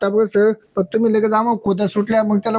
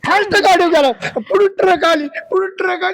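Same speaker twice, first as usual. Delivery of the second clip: fake crying; 7 semitones higher than usual. Fake crying over truck story.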